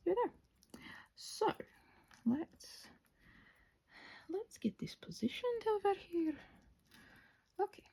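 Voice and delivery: soft unvoiced whisper. Real, not acted. Crafter whispering to viewers while placing the wing, whisper. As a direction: drop to a whisper as if someone is close.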